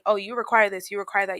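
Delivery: usual speaking voice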